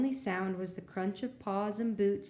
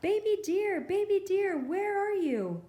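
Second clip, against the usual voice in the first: much higher, louder searching calls; calling out; 10 semitones higher, 4 dB louder than usual. A narrator's reading. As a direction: call out across the snow to find her. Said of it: "the animals cried as they searched".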